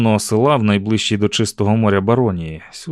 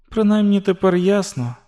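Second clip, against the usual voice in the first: put-on higher-pitched girl's inner voice; young heroine thinking; 9 semitones higher than usual. A narrator's reading. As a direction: think it to yourself as the girl. Narrator voices Susan's inner thought. (високий голос дівчини, думки про себе)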